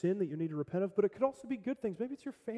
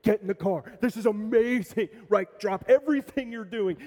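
fast, louder, excited call to the family; excited dad; 3 semitones higher, 6 dB louder than usual. Acted. Dad rushing family to the car, excited. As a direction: hurry everyone out with giddy excitement. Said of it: "he starts beaming in excitement".

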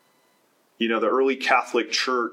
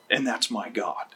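normal talking voice

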